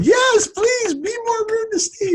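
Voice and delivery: high, slow mock crowd shout; cheering audience. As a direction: squeal it like a cheering fan. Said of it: "Everybody right now is cheering, going".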